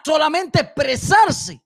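ordinary talk

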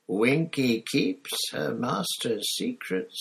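slow, high, defiant elf squeak; stubborn drunk house elf, dragging the words; mutinous tipsy squeak. Acted. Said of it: "she said mutinously".

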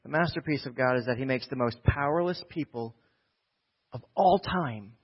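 ordinary talk, nothing marked

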